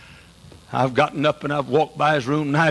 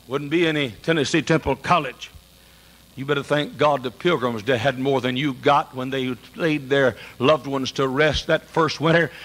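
ordinary talk, nothing marked

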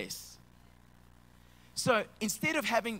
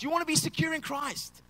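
ordinary delivery

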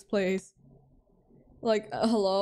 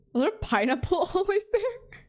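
high-pitched, laughing disbelief; baffled question climbing high at the end; incredulous; 9 semitones higher than usual. Real, not acted. Baffled by object in game.

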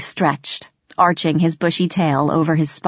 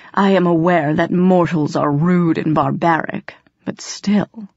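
lightly voiced haughty cat; disdainful cat. Cat reproaching a rude mortal, mildly disdainful.

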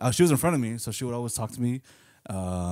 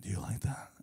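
unvoiced whisper, soft; 4 semitones lower, 10 dB quieter than usual. The girl in class whispering to him. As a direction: breathe it out secretly. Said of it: "then she's like".

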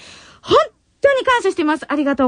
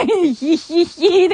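slow drawn-out teasing hee-hee-hee; a playful impish giggle; mock snicker; much slower than usual. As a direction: read it as a sly cackle. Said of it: "こんなことばっかり言っちゃいます".

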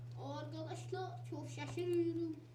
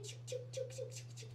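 urgent whisper; 8 semitones higher, 7 dB quieter than usual. Real, not acted. Urging the game to load.

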